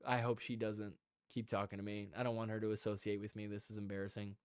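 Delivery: low, soft private worry; embarrassed aside. Retold or imitated. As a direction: keep it down, hoping nobody notices. Embarrassed inner thoughts beside drunk friend.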